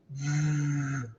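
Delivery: usual speaking voice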